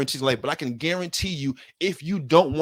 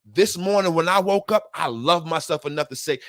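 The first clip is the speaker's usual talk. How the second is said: ordinary talk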